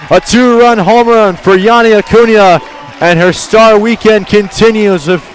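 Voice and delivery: loud, high, thrilled home run call; big home run call, near shouting. Real, not acted. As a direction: ride the ball out of the park with your voice.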